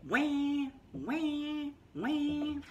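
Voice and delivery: high mock baby wails; baby crying. Teacher voicing baby Louise's wah wah crying.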